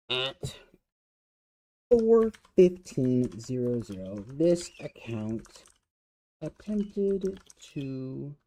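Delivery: slowly dictating a report, choosing words. Shown as a demonstration. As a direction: drag the words while thinking. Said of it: "well, how would we say?".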